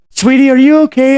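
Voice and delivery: high, loud falsetto call; loud high-pitched husband calling from another room; worried husband. Retold or imitated. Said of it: "you hear his husband going".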